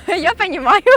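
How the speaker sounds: loud, high, cracking up; laughing through words. Real, not acted. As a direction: try to say it through real laughter. Cracks up modelling the phrase. (громко, высоко, сквозь смех)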